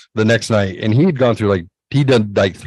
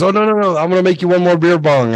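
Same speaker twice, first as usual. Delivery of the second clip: higher, insistent coaxing; mock friend pushing another round; lightly voiced pushy buddy; 7 semitones higher, faster than usual. Friend pushing one more drink.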